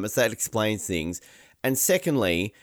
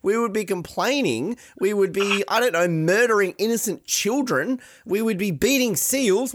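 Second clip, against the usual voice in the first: mock outrage; 8 semitones higher than usual. Real, not acted. Australian imagines outraged reaction.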